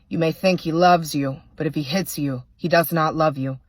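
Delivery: plain reading voice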